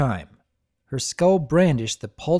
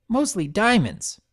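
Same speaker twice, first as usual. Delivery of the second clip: high filly voice; 7 semitones higher than usual. Acted. Voices Scootaloo.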